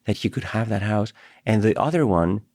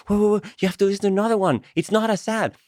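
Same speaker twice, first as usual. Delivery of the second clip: excited retort; 9 semitones higher, faster than usual. Retold or imitated. Own excited retold reply.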